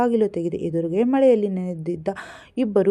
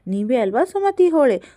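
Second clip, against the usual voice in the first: higher and louder, pointed and accusing; sharp accusing question; 6 semitones higher, 6 dB louder than usual. A narrator's reading. Detective confronting Sumati, sharp and loud. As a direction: nail her with the question.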